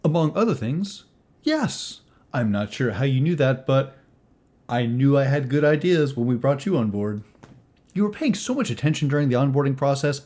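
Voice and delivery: a hint of a pleased employer; lightly voiced employer character. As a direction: talk up the new recruit with confidence.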